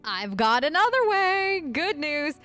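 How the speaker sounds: drawn-out, rising, high-pitched offer; excited announcement. Real, not acted. Player offering a prisoner another way, excited. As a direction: sing out the good news, stretching it high.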